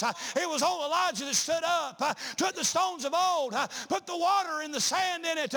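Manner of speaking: rising, fervent sermon delivery; preaching. Acted. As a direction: build the congregation up toward the climax. Preacher building the Elijah sermon.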